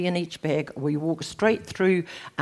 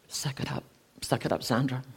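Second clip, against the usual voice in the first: much softer, muttered to herself; self-scolding under breath; 5 semitones lower, 8 dB quieter than usual. Retold or imitated. Holding back tears after the couple laughs, muttered to herself. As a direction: swallow the hurt, barely voiced.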